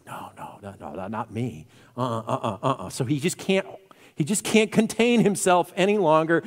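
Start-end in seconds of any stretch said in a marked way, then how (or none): 0.0-2.9 hushed, low string of refusals; muttered inner protest. Acted. Peter protesting inwardly as it boils up, muttered low. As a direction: mumble it under your breath, as if to yourself. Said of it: "everything's boiling up inside of him".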